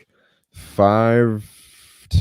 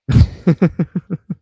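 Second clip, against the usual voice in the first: high laugh; 12 semitones higher, 6 dB louder than usual. Real, not acted. Laughs at own story.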